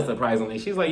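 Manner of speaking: ordinary talk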